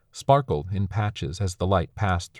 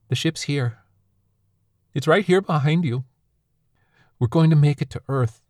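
high, louder urgent reassurance; pleading hope; 8 semitones higher, 4 dB louder than usual. Acted. Son pleading with his dying father, urgent. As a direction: insist through tears that they made it.

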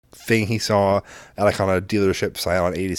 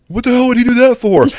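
high, loud mimicked indignation; clueless outraged kid; 13 semitones higher, 7 dB louder than usual. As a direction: imitate a kid's outburst, pitched way up. Clueless son reacts in retold argument.

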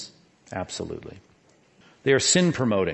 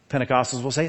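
usual speaking voice

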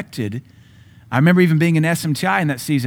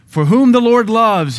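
much higher and louder, slow proclamation; booming scripture; 10 semitones higher, 8 dB louder, slower than usual. Acted. Preacher proclaims scripture to the congregation, booming and slow. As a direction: proclaim it from the pulpit.

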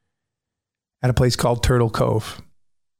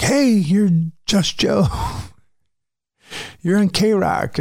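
high, slow, excited recognition, with a laugh; starstruck fan; 8 semitones higher, slower than usual. Retold or imitated. EMT recognizing the radio host, high and starstruck. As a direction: play the medic who just spotted a radio guy. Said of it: "the one guy's like".

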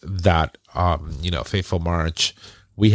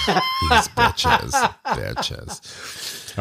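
laughing shout; much slower than usual. Real, not acted. Joking shout at co-hosts.

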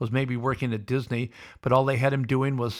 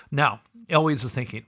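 usual speaking voice

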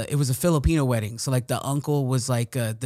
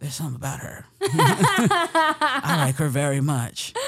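laughing; 3 semitones higher, slower than usual. Retold or imitated. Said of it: "And I was like".

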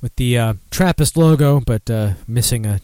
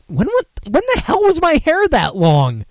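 much higher, disbelieving squeal; stunned; 14 semitones higher, 3 dB louder than usual. Real, not acted. Host seeing his teenage photo on stream, high shocked squeal. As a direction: pitch it up in real shock.

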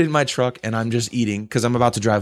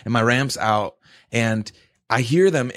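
ordinary delivery

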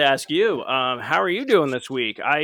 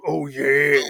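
Kool-Aid Man; 5 dB louder than usual. Retold or imitated. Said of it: "it's all like".

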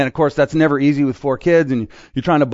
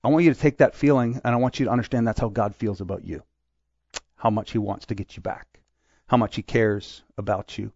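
hushed, lower tender plea; tender Jesus; 5 semitones lower, 6 dB quieter than usual. Retold or imitated. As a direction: lower and softer, sincere. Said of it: "Jesus is like".

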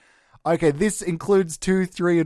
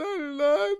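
mock crying; 10 semitones higher than usual. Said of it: "This is me really crying".

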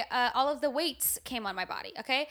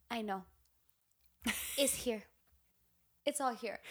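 quiet confirmation; 2 semitones lower, 8 dB quieter than usual. Retold or imitated. Dad confirming daughter's weight gain, quiet and halting. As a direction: imitate a dad quietly breaking it to his daughter.

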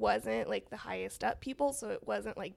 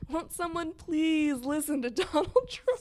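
mock plea; 6 semitones higher, 5 dB louder than usual.

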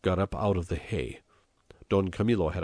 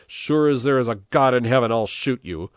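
loud, raised threat easing at the end; exclaimed threat; 6 semitones higher, 9 dB louder than usual. A narrator's reading. Mayor cutting the priest short, exclaimed threat. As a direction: swear the threat at high pitch and volume. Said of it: "he exclaimed".

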